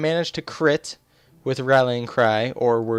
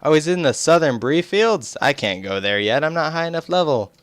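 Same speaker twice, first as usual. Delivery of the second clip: usual speaking voice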